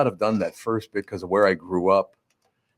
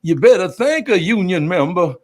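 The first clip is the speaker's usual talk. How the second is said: higher, louder put-on preacher-style voice; mock audience-pandering voice; 10 semitones higher, 6 dB louder than usual.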